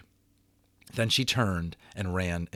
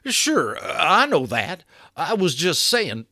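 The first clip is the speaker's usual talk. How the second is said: higher, louder hasty backpedal; hasty cowboy; 8 semitones higher, 6 dB louder, slower than usual. A narrator's reading. Boone hastily backs down to Patrick, higher and louder. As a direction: cover yourself quickly. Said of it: "said Boone hastily".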